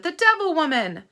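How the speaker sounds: brand her before the crowd; shouted accusation. Acted. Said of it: "Trout shouted".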